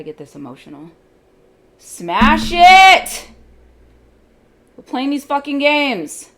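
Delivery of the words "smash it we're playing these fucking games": much higher and much louder, full yell; furious shout. Real, not acted. Trader screaming at the chart to hit target. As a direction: scream at the market to smash it.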